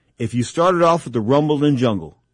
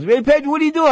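much higher, louder, a hammed-up boxing promoter's greeting; Don King voice; 13 semitones higher, 5 dB louder, faster than usual. Don King calling Pedro over, in a high hammed-up greeting. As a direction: play the big boss calling his guy over. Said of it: "he says".

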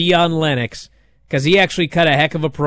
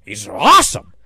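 sarcastic put-on voice, sneering; mock the latecomers' sudden praise; snide imitation of fickle fans; mocking fake praise; 3 semitones lower, 4 dB louder than usual. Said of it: "Now you act like".

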